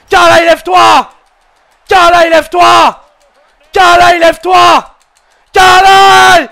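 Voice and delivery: desperate fan screaming at the TV; shouting. Real, not acted. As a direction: yell at the screen for her to get up. Pleading shouts at fallen wrestler.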